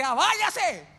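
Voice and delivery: loud, high yell to get out; mock furious send-off; angry dismissal. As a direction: burst out, shooing someone away. Imagined angry dismissal in his place.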